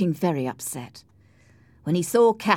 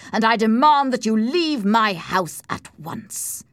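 loud, slow, raised-voice demand; angry order; 6 semitones higher, 6 dB louder than usual. A narrator's reading. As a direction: order him out forcefully. Edgar orders Heathcliff out.

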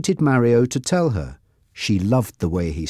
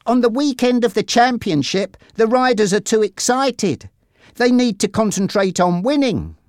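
put-on higher boyish voice, knowing; lightly voiced boy; 11 semitones higher than usual. A narrator's reading. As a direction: lift the voice for a teasing brother. Jack's dialogue in story.

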